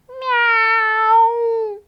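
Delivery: high, loud mewing cat; cat meow. A narrator's reading. The cat answering Mister Twiddle, a high loud meow. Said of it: "said the cat".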